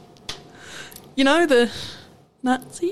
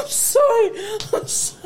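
high, real laughing apology; apologising through laughter; 9 semitones higher than usual. Real, not acted. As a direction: squeeze it out mid-laughing fit. Apologising amid laughing fit.

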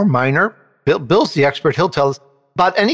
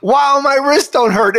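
amazed wife; 10 semitones higher, 3 dB louder than usual. Retold or imitated. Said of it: "And my wife is going".

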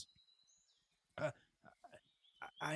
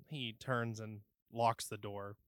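normal talking voice

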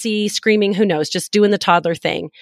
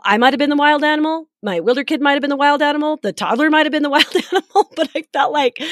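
quick, higher, tongue-in-cheek list; playful; 7 semitones higher, faster than usual. Acted. Mom joking about who was the wild animal, quick and raised. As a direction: build the joke line by line, higher each time.

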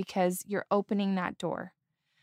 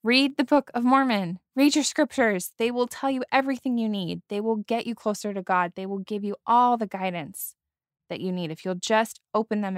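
raised, insistent urging; higher, louder earnest exhortation; urgent plea to oneself; 3 semitones higher, 4 dB louder than usual. Retold or imitated. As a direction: plead as if shaking your younger self. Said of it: "just shake myself and say".

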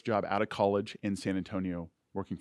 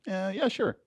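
naive younger self; 7 semitones higher, 3 dB louder than usual. Retold or imitated. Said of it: "I was like".

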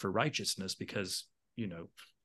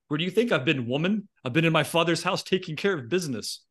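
sassy; 6 semitones higher, 4 dB louder than usual. Retold or imitated. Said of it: "he's like".

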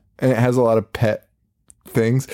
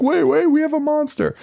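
much higher, urgent squeak; frantic kids' voice, pitched way up; mock frantic plea. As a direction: blurt it out in a panic. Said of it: "And they're like".

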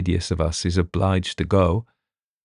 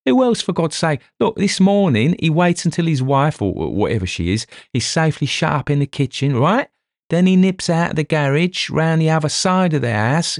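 excited blustering; 10 semitones higher than usual. Acted. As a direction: hammer home the obvious theory. Dover excitedly lays out theory.

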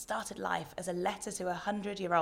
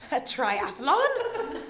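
laughing question; 8 semitones higher, 6 dB louder, much slower than usual. Real, not acted. Host laughing at guest's joke.

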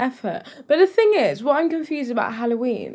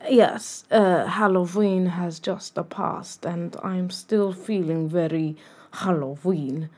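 put-on slow, low, soft Halloween accent; Halloween fancy-dress accent; 3 semitones lower, 5 dB quieter, slower than usual. Co-host still feeling Halloween, slow put-on accent. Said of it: "accent in honor of Halloween".

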